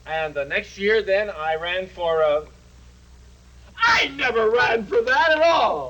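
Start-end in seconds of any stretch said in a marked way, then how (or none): 3.8-5.9 sudden loud indignant shout; angry outburst. Acted. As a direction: blurt it out, offended. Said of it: "Mustn't lose your temper in public".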